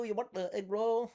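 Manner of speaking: high-pitched mock Vietnamese accent; Vietnamese vendor accent. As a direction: play an eager food seller in a hammed-up Vietnamese accent. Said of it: "the Vietnamese booth, they're like".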